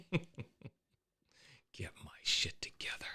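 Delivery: breathy whisper, slowly. Shown as a demonstration. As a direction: whisper it to yourself. Repeats the line under her breath.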